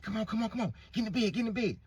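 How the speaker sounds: put-on high, urgent girl's voice; hurried daughter. Daughter hiding her mother's lover, high urgent voice. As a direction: rush him into the bed.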